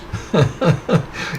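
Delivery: short run of light, breathy chuckles; amused chuckle. Real, not acted. Lecturer amused by the rascals in his story, chuckling.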